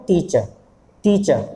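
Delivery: टीचर with long ee, ending in a schwa; silent final R. Shown as a demonstration. Teacher models for learners, टीचर with silent R. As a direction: say टीचर twice, no R at the end. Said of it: "रा नहीं बोलना".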